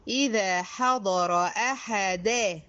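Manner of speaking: أحدا with the final fatha clearly drawn out; stressing the fatha. Shown as a demonstration. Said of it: "خدي بالك من الفتحة". (إبراز فتحة أحدا)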